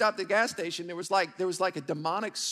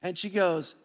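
normal talking voice

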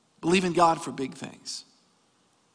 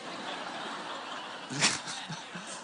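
breathy, voiceless laughing fit; 4 semitones lower, 13 dB quieter than usual. Real, not acted. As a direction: laugh helplessly at your own joke. Laughs at own joke.

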